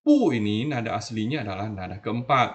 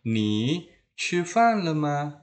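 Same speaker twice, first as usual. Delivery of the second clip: "Ni chi fan le ma?" slow, higher, with chi fan held out; model question; 4 semitones higher, slower than usual. Shown as a demonstration. Teacher shows how to ask if someone has eaten, slow model question. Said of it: "ngomongnya seperti ini".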